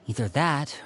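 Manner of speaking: normal talking voice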